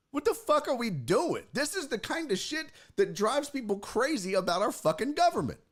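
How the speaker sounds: high-pitched exasperated rant; worked-up, incredulous complaint; getting mad. Retold or imitated. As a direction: rail in disbelief, voice climbing. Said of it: "I was getting mad".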